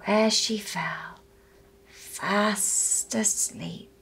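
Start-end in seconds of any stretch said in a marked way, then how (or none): none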